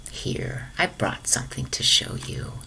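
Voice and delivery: hushed secret whisper. Acted. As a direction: lean in and whisper it. Grandma shows secret photo.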